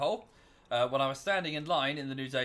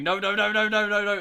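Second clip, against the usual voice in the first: rising frantic no no no; loud, desperate repeated no; panicked no's; 5 semitones higher, 8 dB louder than usual. Retold or imitated. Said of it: "ran back down the road going".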